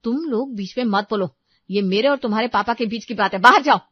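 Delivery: loud scolding building to a shout; angry mother. Acted. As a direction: order the children out, exploding at the end. Mother orders children out. (गुस्से में चिल्लाती माँ)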